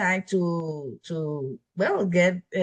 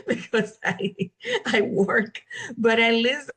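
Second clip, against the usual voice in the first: a little higher, laughing through it; 5 semitones higher than usual. Real, not acted. Guest confessing her study habit to the coach, laughing. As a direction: giggle while admitting it. Admits listening seventeen times, laughing.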